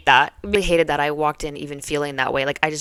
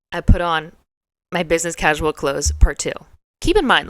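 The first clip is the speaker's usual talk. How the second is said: ordinary talk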